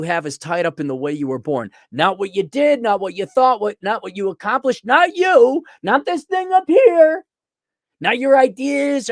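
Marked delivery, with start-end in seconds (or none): 4.9-9.1 high, loud, drawn-out emphasis; slow, high exasperated insistence; exasperated rant. Real, not acted. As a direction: hammer each denial, pitched way up. Ranting, mounting exasperated list.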